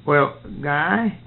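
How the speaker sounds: ordinary delivery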